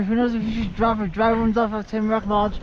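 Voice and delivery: ordinary talk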